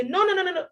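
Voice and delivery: "no, no, no" in a rapid high string; emphatic repetition. Shown as a demonstration. Teacher giving an example of emphasis, repeated insistent no. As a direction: pitch no, no, no up high, run together. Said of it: "to make you emphasize on the word".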